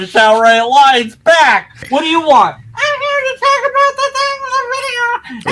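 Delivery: very high squeaky cartoon voice; funny squeaky voice. Shown as a demonstration. Demonstrating a silly voice for an off-screen character, very high squeaky. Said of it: "a funny voice or something".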